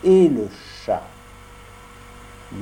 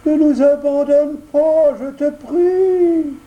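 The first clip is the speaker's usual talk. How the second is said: much higher, whining begging voice, slow; tearful fox; 6 dB louder, slower than usual. Acted. Sly fox begs Pinocchio, whining with put-on tears. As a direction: beg with fake tears.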